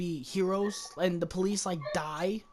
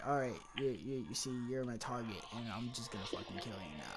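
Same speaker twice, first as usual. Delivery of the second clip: emotionless Terminator; 7 semitones lower, 10 dB quieter, faster than usual. Retold or imitated. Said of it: "the Terminator just has no emotion".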